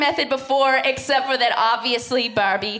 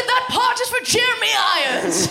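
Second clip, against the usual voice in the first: high, hammed-up outcry; theatrical protest; 12 semitones higher than usual. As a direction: proclaim it in mock indignation. Acted retelling of own protest.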